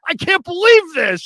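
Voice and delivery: imitating a high, loud outraged yell; totally pissed. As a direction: wail it in hammed-up fury. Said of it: "He's, like, totally pissed".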